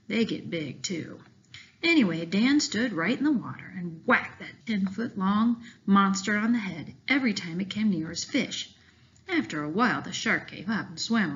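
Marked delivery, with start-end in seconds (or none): none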